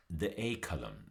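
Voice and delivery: ordinary delivery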